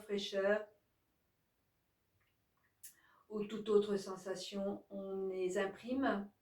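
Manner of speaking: calm, even yoga nidra guidance; guided relaxation. Acted. Yoga teacher guiding listeners to release each body part, calm guided relaxation.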